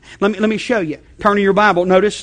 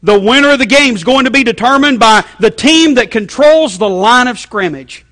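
analyst voice, higher and louder; mock sports analyst; 5 semitones higher, 7 dB louder than usual. Preacher imitating a TV analyst's keys to the game, loud. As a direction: pronounce it like a TV pundit. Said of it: "here's what the analyst".

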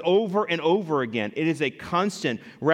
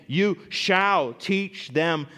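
"you shall teach them" spoken very slowly, word by word; slow command; much slower than usual. Acted. Preacher reading the command on teaching children, slow and deliberate.

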